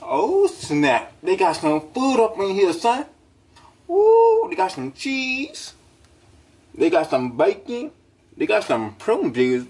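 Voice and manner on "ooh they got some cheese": excited ooh. Acted. Burglar finds cheese.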